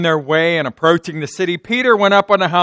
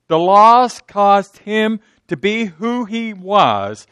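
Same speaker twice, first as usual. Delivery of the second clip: slow, louder preaching push; emphatic preaching; 4 semitones higher, 4 dB louder, slower than usual. Acted. Preacher driving home a point.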